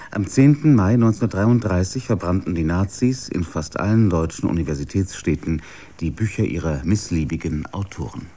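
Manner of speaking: ordinary talk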